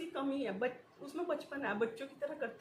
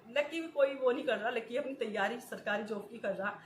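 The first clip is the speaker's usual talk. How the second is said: usual speaking voice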